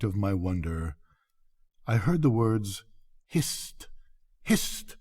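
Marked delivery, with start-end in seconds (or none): 3.3-5.0 quick, hushed warning whisper; cautious whisper. A narrator's reading. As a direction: hiss it urgently so no one else hears. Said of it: "ejaculated quickly but cautiously".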